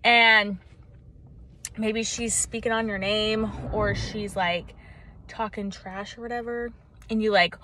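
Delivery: normal talking voice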